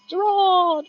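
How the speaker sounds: very high, loud shouted name; rum impression. Streamer calling a viewer's name in a rum-soaked impression. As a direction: belt the name out high like a drunk pirate. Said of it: "That was my rum impression".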